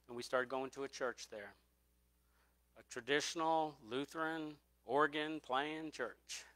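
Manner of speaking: ordinary delivery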